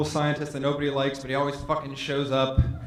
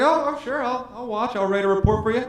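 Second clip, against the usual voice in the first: high, eager nerdy voice; eager nerd voice; 7 semitones higher than usual. Retold or imitated. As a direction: overeager to please. Eager uncool scientist replies.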